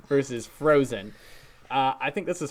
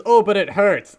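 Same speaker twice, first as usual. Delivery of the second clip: higher, louder mock protest; imitated cartoon complaint; 6 semitones higher, 8 dB louder than usual. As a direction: protest loudly like a hurt cartoon hero. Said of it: "he goes".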